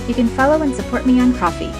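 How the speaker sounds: usual speaking voice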